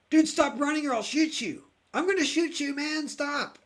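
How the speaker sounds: high, loud shouted warning; panicked yelling threat. Retold or imitated. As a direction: yell at him to stop before you shoot. Sheriff yelling at fleeing prisoner.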